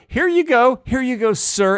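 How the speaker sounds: high, deferential pretend courtesy; mock respectful. Comedian playing the helper handing an angry person a chair, mock respectful. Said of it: "be very respectful".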